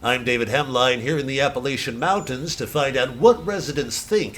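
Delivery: bright on-camera reporter read; news reporter. Acted. Reporter opening his piece to camera, upbeat news read. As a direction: sell the intro like a TV correspondent.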